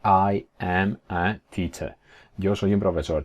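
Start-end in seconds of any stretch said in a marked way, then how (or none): 0.0-1.9 word by word, slowly; slow uncontracted textbook delivery; slow full form. Shown as a demonstration. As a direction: separate every word of "I am a teacher". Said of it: "pues no te va a decir".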